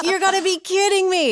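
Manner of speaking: high, loud incredulous cry; can't believe it; stunned disbelief. Retold or imitated. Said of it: "I was like".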